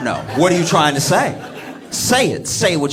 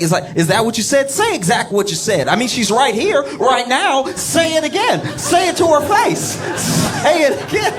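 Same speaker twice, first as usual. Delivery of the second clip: mock goading lawyer; 10 semitones higher than usual.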